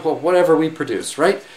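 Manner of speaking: ordinary talk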